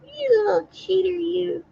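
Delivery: put-on high-pitched teasing Texas accent; mock Texas accent. Imitating a woman insulting her friend, high mock Texas accent. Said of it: "Texas accent?".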